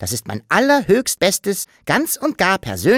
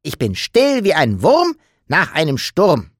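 put-on mildly raised childlike voice; the Sams a touch louder, correcting itself; a bit louder; 5 semitones higher, 5 dB louder than usual. Sams corrects its rhyme to Papa, a little louder. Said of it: "verbesserte es sich schon ein wenig lauter".

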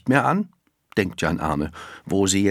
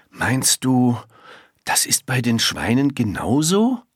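hesitant question, pitch lifted; boy wondering, voice rising in doubt; doubtful; 5 semitones higher than usual. A narrator's reading. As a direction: ask it with a pause after "du", unsure. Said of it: "sagt er zweifelnd".

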